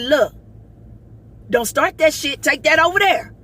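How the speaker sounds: loud, sharp warning, high and forceful; telling her off. Retold or imitated. To a woman approaching her in the ER, loud warning. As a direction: shout her off, no nonsense. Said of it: "I said".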